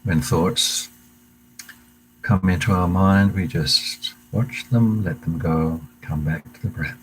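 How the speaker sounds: calm meditation guide voice, unhurried pauses; guided meditation. Acted. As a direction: lead the class gently into the breath. Leads a breathing meditation.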